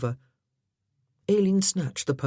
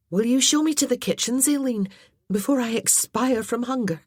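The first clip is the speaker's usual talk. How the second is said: higher, louder, fast mock-dramatic plea; playful hungry lady; 8 semitones higher, 5 dB louder than usual. A narrator's reading. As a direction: play up your hunger with a grin. Maggie voiced, joking.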